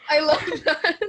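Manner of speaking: laughing loudly through the words. Real, not acted. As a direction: break into laughter mid-sentence. Second take laughing.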